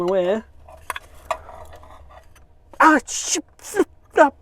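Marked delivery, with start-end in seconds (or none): 2.8-4.3 sudden loud pained cry. Real, not acted. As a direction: cry out as if just burned. Burned himself.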